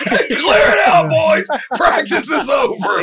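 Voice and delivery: high, loud alarmed command; urgent shout. Retold or imitated. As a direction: clear the field, panicked. Coach ending practice in alarm.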